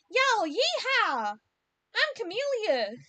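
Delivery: high, loud, slow yeehaw Southern twang; mock Southern accent. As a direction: ham up a Southern cowboy accent. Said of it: "with a Southern accent".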